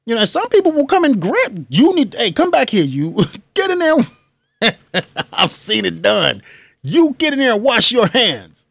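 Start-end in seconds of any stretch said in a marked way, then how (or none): 2.1-4.0 high mock call, scolding; calling someone back. Telling a bathroom stranger to go wash, high scolding call. 6.8-8.7 raised bossy command; scolding order. Retold or imitated. Ordering a stranger to wash their hands, raised scolding.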